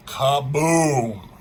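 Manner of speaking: loud, drawn-out mock blast; explosion effect. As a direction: boom it out like a comic-book explosion. Voicing an explosion in the story.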